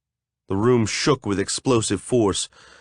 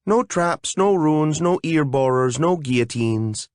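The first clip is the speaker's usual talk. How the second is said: higher, brisk fairy ticking off dangers; 5 semitones higher than usual. Acted. A fairy checking the door for traps, chirpy and higher. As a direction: rattle off the list like a chirpy fairy.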